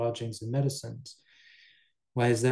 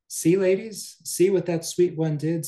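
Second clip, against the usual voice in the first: higher, louder outcry; calling out to neighbors; 6 semitones higher, 5 dB louder than usual. Acted. Injured maid calls the neighbor women to witness, in a high, loud outcry. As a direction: call the neighbors over in a raised voice.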